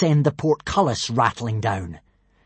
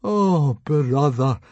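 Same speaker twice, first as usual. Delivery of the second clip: moan; much slower than usual. Acted. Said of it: "moaned Fishlegs miserably".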